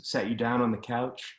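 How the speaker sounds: usual speaking voice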